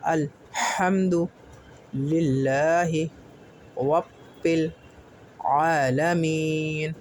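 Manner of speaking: slow Quranic recitation; recitation to follow. Shown as a demonstration. Teacher reciting while students read along. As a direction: recite slowly for students to repeat. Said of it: "ساتھ میں پڑھیے میرے".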